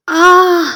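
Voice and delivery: sudden high yelp of pain; pained cry. A narrator's reading. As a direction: cry out as you fall. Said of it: "a loud voice came out of his mouth".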